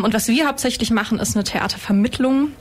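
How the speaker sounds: usual speaking voice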